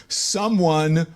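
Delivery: very slow, word by word; emphatic spelling-out. Real, not acted. Host spelling out racism's definition to caller, slowly and deliberately. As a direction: space out each word to drive it home.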